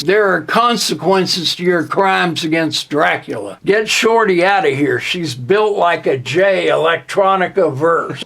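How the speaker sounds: normal talking voice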